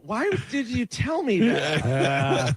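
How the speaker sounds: high, drawn-out disbelieving question; retold incredulity. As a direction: stretch it out high in mock dismay. Retold incredulous reaction to payment.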